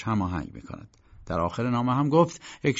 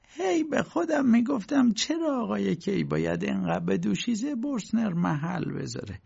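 put-on high, anxious old landlady voice; landlady pleading her innocence to her lodger; fretful landlady; 7 semitones higher than usual. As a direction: fuss and justify yourself, higher pitched. Landlady Grubach voiced in story.

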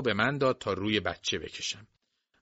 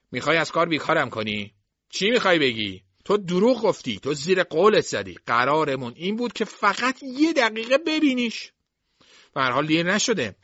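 higher and louder, rising heat; angry scolding; 8 semitones higher, 4 dB louder than usual. Acted. Brother berates sister for coming to the shop, angry and raised. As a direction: tear into her for breaking the deal.

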